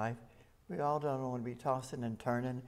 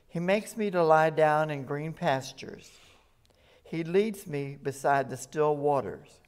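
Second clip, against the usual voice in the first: solemn psalm reading, swelling louder on key lines; scripture reading; 4 dB louder than usual. Acted. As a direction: read the psalm as proclamation to the congregation. Reads Psalm twenty-three aloud.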